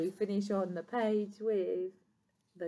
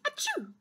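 quick high-pitched "achoo"; acted sneeze. A narrator's reading. Porcupine's nose is tickled, acted sneeze. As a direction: fake a little high sneeze. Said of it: "She sneezed".

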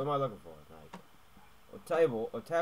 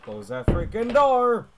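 slow, high, loud shout; angry yell; 11 semitones higher, 15 dB louder, slower than usual. Real, not acted. Player yelling at the homeowner in the game, loud slow shout. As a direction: bellow it at the screen.

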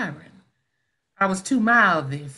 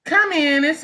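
raised, higher call toward the door; 7 semitones higher, 4 dB louder than usual. Acted. Hostess calls to the knocking visitor, raised call. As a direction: call out loud so the visitor hears.

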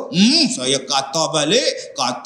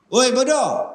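milder mock insult; 9 semitones higher than usual. Acted insult repeated.

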